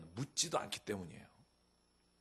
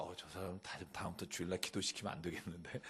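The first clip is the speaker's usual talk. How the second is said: much softer and lower, a sly aside; muttered church gossip; 6 semitones lower, 10 dB quieter than usual. Retold or imitated. Congregation members judging the doubter, hushed disapproving aside. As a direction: say it under your breath like a gossiping churchgoer. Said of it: "그 사람에게 뭐라 그러겠어요?".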